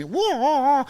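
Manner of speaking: high, drawn-out "whoa" battle cry; mock kung fu cry. Bouncer jumping up to attack, mock kung fu yell. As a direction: imitate a martial-arts yell.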